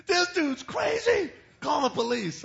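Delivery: high, drawn-out panicked mock scream. Beaten wimp yelling to the pastors, screaming. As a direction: imitate a frantic man yelling for help at full volume. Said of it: "this guy's screaming".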